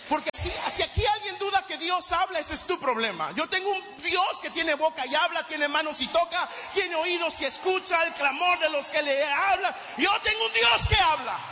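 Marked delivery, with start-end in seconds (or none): 3.3-11.4 preaching shout. Real, not acted. Preacher proclaims to the congregation that God speaks, rising shout. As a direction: build it up like a revival peak.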